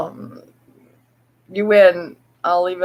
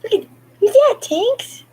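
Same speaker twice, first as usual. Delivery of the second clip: much higher, eager cooing; excited pet talk. Real, not acted. Owner showing Tinks the mirror, excited high pet talk. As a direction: point it out to the cat with delight.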